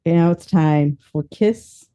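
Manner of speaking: ordinary talk, nothing marked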